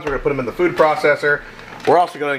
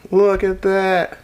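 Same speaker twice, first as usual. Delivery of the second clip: amazed; 7 semitones higher, much slower than usual. Real, not acted. Admiring the giant onion ring.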